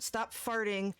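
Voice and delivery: ordinary talk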